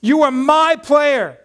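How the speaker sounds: loud, high, emphatic pep talk; rousing coach. Retold or imitated. Coach forgives his player and sends him out, rousing shout. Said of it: "and he says".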